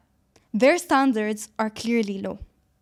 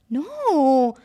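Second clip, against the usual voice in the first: emphatic no; 5 dB louder than usual. Acted. Emphatic refusal to moms.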